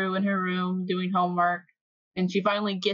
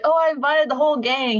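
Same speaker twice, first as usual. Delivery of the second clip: higher, louder, imitating an excited inviter; cheerful announcement; 7 semitones higher, 5 dB louder than usual. Retelling him inviting the whole gang, excitedly. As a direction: announce it brightly in a raised voice.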